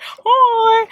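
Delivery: high, drawn-out mock "hi"; playful high-pitched copy of the greeting; imitating friends' greeting. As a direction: mimic their high-pitched hello. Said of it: "They're like".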